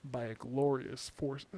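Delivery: talking around a vape in mouth; words muffled by something in the mouth. Real, not acted. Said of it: "I can't talk with vape in my mouth".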